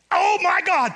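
high, loud shout of surprise; surprised cry. Retold or imitated. Pastor reliving the knot popping loose, shocked shout. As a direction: blurt it out as the knot gives way.

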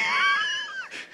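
a burst of high-pitched laughter. Real, not acted. Pastor bursts out laughing at his own joke. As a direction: crack up at your own joke.

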